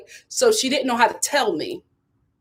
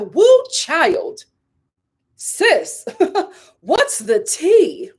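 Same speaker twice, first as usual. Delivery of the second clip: very slow, higher drawn-out sass; sassy gossip read; 6 semitones higher, much slower than usual. Retold or imitated. Mocking the script's sassy line, slow. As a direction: stretch it out sassy. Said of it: "read it how them demons wrote it".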